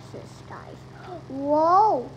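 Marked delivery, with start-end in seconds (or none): 1.3-2.1 startled shout. Real, not acted. A near crash in game.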